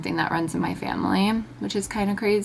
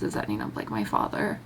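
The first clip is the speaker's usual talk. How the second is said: choking up; 10 semitones lower, 4 dB quieter than usual. Real, not acted. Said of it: "I'm gonna cry".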